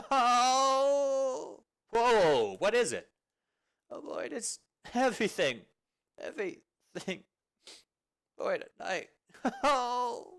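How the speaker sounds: loud, high wailing sobs breaking into words; sobbing wail that won't stop; bursting into tears. Acted. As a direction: cry it all out to a friend, voice cracking. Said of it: "if you need to cry".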